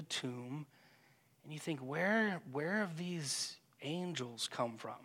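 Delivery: soft, hushed meditation voice, trailing very quiet; gentle contemplative prayer guide; guided meditation. Acted. Leads a silent imaginative prayer.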